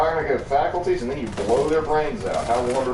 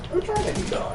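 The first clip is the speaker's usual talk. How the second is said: high mock voice; 3 dB quieter than usual. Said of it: "the private's last words were".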